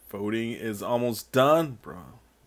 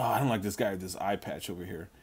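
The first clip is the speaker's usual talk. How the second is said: usual speaking voice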